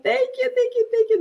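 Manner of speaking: high, squealy thank-yous; a high-pitched excited thank you; gushing thanks. Real, not acted. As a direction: gush with delight at the compliment.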